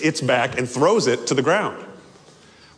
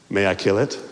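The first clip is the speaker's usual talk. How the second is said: calm angel; 8 semitones lower, faster than usual. Acted. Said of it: "in a very calm manner".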